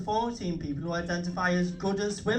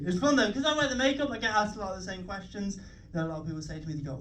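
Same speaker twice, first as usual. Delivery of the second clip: ordinary delivery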